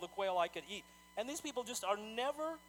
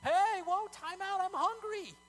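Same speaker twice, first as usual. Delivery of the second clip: high, louder, mock-whiny protest; whiny hungry complainer; 12 semitones higher, 8 dB louder, slower than usual. Preacher mocking the Israelites complaining they're hungry, high mock whine. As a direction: whine like a spoiled kid who skipped the manna. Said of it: "they were like".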